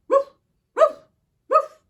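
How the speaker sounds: high, loud yappy woofs; little dog woofs. Acted. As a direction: yap like a small puppy. Wolf tries to howl/bark.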